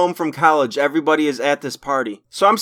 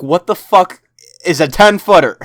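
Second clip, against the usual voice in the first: blunt disbelief; 4 dB louder than usual. Retold or imitated. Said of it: "I said it more nicely than that".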